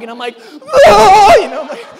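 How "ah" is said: very loud, high shriek; sudden full-force yell of disgust and panic; panicked scream. Retold or imitated. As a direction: scream in shock at touching it. Acting out hitting the frog.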